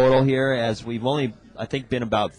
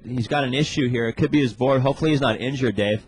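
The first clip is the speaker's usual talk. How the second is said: surf contest announcer; 3 semitones higher than usual. Real, not acted. Live surf contest commentary.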